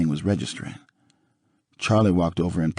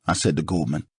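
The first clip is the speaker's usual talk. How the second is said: plain reading voice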